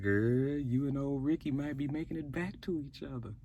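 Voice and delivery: low, sly gossip aside; teasing aside to Grace. Real, not acted. Recapper teasing Grace about her ex, low and sly. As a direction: murmur a knowing tease. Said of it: "I was like".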